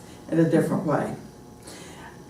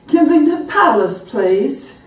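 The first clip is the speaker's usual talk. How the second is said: high, loud put-on British accent; 9 semitones higher, 5 dB louder, slower than usual. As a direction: announce it in an exaggerated British accent. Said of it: "to try to use a different accent".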